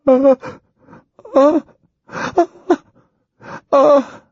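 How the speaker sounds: high, rhythmic frightened ah cries; terrified chant. Acted. Ginger in trance, chanting high in terror. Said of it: "monotonous chant of stark terror".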